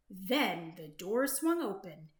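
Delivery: ordinary delivery, nothing marked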